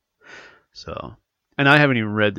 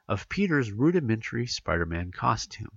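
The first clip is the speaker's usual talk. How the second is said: ordinary talk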